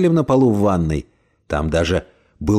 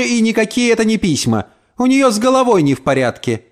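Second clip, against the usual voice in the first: high-pitched scornful verdict; spiteful rant; 7 semitones higher than usual. Acted. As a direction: dismiss her as mad. Landlady rants about neighbour. (язвительно, голос выше)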